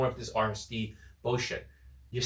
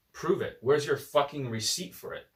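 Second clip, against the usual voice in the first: normal talking voice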